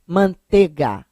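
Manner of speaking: "Manteiga" dito "mantêga"; pronúncia carioca sem ditongo. Shown as a demonstration. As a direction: drop the I glide in "Manteiga". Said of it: "Porque o carioca fala". ("Manteiga" com E fechado, sem I)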